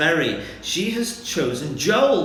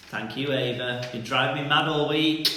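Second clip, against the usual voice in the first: ordinary talk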